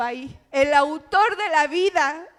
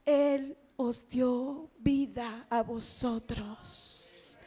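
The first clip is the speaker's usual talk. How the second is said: hushed solemn preaching; 4 semitones lower, 7 dB quieter, slower than usual. Acted. Baja a voz íntima.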